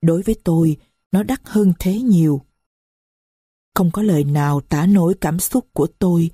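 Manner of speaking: ordinary delivery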